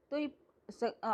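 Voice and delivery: ordinary delivery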